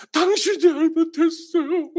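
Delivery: laughing wife. Retold or imitated. Husband imitating his laughing wife's reply, high and giggly. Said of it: "그러면 제 처가".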